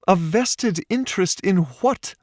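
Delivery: Paige's high puzzled question. A narrator's reading. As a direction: press him with his own words. Paige questions his words.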